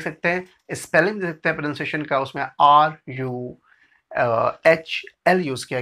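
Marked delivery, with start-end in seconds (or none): none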